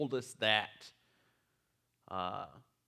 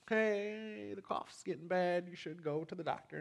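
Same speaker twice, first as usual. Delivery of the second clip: nudging tone; 8 semitones higher than usual. Retold or imitated. Nudging wife to see doctor.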